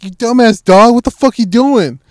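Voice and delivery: loud, high-pitched telling-off; retold angry scolding. Owner scolding his lost dog in the cul-de-sac, loud and exasperated. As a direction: holler at the dog, re-enacted.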